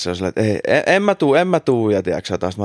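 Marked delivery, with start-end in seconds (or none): 0.4-1.9 higher, mildly retold panicked refusal. Friend refusing to go to his brother, retold mild panic. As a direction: protest with a hint of panic, imitated. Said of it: "sit se on ihan paniikissa".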